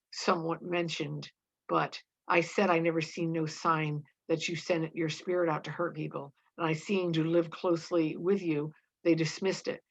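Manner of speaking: ordinary delivery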